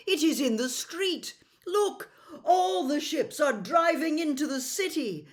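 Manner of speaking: a prince pointing at the rising flood in alarm. Acted.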